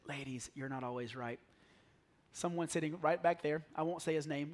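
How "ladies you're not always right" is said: much softer, imitating his own whisper from first service; retold whisper. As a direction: hush it down like a confession to the ladies. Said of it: "I whispered, I said".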